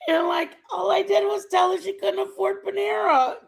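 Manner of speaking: high, loud voice breaking into laughter; laughing protest. Real, not acted. Defending herself over a Panera remark, laughing protest. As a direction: protest your innocence through real laughter.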